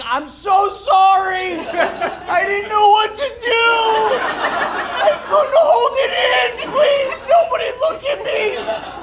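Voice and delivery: a businessman's high, slow, desperate scream; panicked screaming. Retold or imitated. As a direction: shriek your apology in a full panic. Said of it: "he starts screaming".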